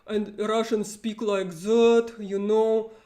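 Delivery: exaggerated Russian accent, loud and heavy; mock the YouTube Russian accent; Russian accent imitation. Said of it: "they imitate the Russian accent".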